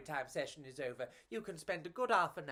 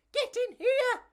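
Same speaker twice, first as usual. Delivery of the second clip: very high, louder call; tiny bug voice; 8 dB louder than usual. Acted. Pedro calling Enrico into a hotel, very high call. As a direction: call him into the hotel, squeaky. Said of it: "Pedro said".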